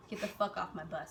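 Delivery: low, quiet imitated order; deceptively nice. Sergeant orders recruits off the bus, deceptively nice. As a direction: say it softly, as if all is nice. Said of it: "like, all nice".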